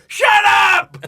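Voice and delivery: very high, very loud yell; a wife yelling from the living room to stop the singing; shouted shut up. Retold or imitated. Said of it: "from the living room".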